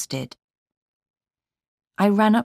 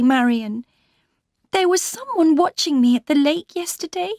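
put-on high-pitched, urgent young wife; anxious heroine, pitched well up; distressed Laura; 9 semitones higher than usual. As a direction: pour out the story in a high, upset voice.

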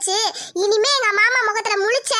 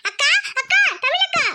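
put-on higher girlish little-sister voice; an eager younger sister calling her big sister; 5 semitones higher than usual. Younger sister Isai runs in calling her elder sister.

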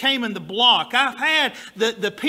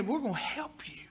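whisper; 3 semitones higher, 10 dB quieter than usual. Retold or imitated. Mocking clerk's quiet offer.

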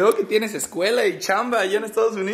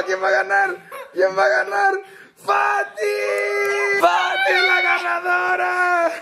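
high shouting ring announcer; announcer hype; 9 semitones higher than usual. Acted. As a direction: call out the winner. Announcing winner.

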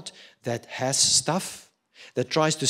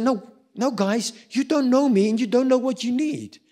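higher, louder mock protest to friends; exasperated brush-off; 10 semitones higher, 4 dB louder than usual. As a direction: wave them off, a touch exasperated. Retold rebuke of people.

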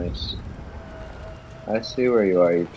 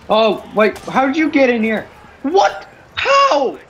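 high-pitched squeal of surprise, climbing higher; startled disbelief; 4 dB louder, slower than usual. Real, not acted. Player caught off guard by an intruder inside, startled disbelief. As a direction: squeak it out, incredulous, near laughter.

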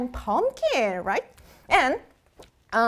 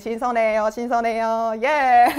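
ordinary delivery

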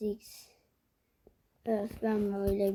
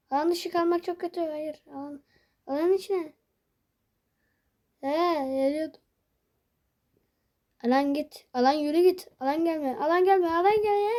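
higher, rising panicked pleading; 5 semitones higher than usual. Real, not acted. Gamer outside the zone begging it not to come, panicked. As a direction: beg the zone to stay away.

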